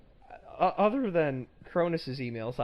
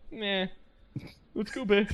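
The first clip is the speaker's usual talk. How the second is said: higher, louder bored brush-off; mocking the uninterested audience, higher and louder; bored dismissive listener; 8 semitones higher, 4 dB louder, slower than usual. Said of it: "have people go".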